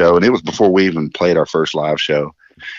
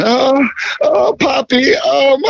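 high, hammed-up moaning; much higher mock moans imitating the vocalist; much slower than usual. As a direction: ham up a moaning singer. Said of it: "he's moaning and groaning".

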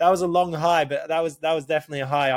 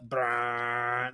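quad bike revving past, done by mouth; imitated engine noise; 3 semitones lower, 4 dB quieter than usual. Telling friends the quad came round, long engine brrr. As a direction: roll out a long motor brrr.

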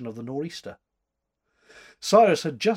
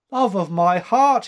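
high, loud outpouring of love; cried out; 6 semitones higher, 9 dB louder than usual. A narrator's reading. Cyrus declaring his love to the lassie, crying out loud. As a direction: cry it out in joyful passion. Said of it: "he cried".

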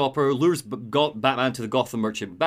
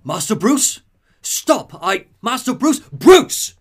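Alfred calling out, louder and higher, up to a shout; calling after him; 9 semitones higher, 9 dB louder, slower than usual. Retold or imitated. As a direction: call after him as he walks away, growing desperate. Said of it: "Alfred starts to call after him".

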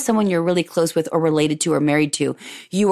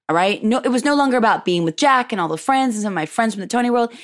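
ordinary talk, nothing marked

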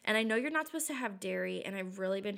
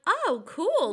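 high and louder forced brightness; fake cheerful; 9 semitones higher, 6 dB louder than usual. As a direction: chirp it back brightly. Fake-cheerful reply while miserable.

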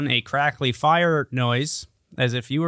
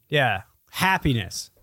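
ordinary talk, nothing marked

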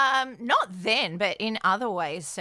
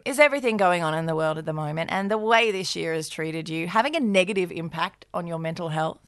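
ad read; 4 semitones lower than usual. Acted. BetterHelp ad read by both.

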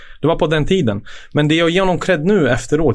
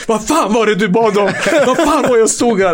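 laughing quote; 9 semitones higher than usual. Retold or imitated. Captain's mocking question, retold.

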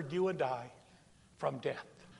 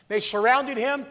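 usual speaking voice

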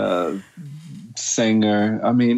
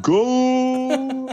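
mock goal call.

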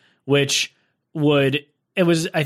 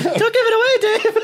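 much higher falsetto, laughing; falsetto fiance voice; 3 dB louder, slower than usual. Pretend. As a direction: squeak it out in falsetto through a laugh. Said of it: "he's doing both voices".

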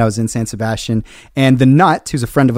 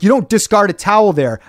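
angry; 6 semitones higher than usual. Retold or imitated. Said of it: "I'm so mad".

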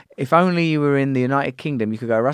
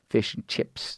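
slow Kiwi "fish and chips"; New Zealand accent; 3 semitones lower, 7 dB quieter, slower than usual. Shown as a demonstration. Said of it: "difference between New Zealand people talking".

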